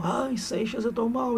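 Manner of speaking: high, put-on swooning lady; mock faint complaint. Aurélia fakes illness to Seixas, high swoony voice. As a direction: whimper it like a fake-ill lady.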